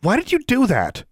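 high, loud demand; high insistent demand. A narrator's reading. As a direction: demand an answer from him. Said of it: "she demanded".

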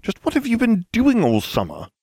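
rapid, high-pitched protest; insistent. A narrator's reading. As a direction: rattle it off as if correcting the record. Recounting a dispute, insisting.

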